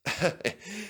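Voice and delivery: quiet, breathy little laugh; soft chuckle. Acted. Culdee brushing off praise with a soft chuckle. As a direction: laugh off the compliment. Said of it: "laughed Culdee".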